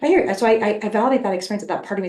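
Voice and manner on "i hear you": lilting high reassurance; high soothing echo. Retold or imitated. Echoing reassurance to her anxious part, high. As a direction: repeat it gently, up high.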